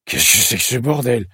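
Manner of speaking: put-on startled old man's rasping outburst; rough angry splutter. A narrator's reading. Old man jolted awake in his car, spluttering angrily. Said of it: "éructe-t-il".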